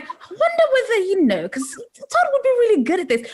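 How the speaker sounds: excited fan daydreaming; high excited musing. Retold or imitated. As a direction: gush with delight at the idea. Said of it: "I was like".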